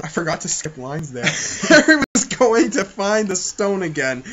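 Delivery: ordinary talk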